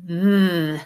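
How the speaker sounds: "mm" hummed with the voice on; voiced buzz demo; voiced th sound. Shown as a demonstration. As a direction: hum "mm" with the tongue under the teeth. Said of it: "turn on your voice box".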